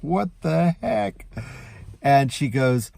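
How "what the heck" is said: mock alarm.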